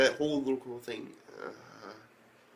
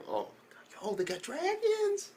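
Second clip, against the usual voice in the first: hammed-up fanboy wonder; mock amazement. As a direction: stretch it out in high-pitched disbelief.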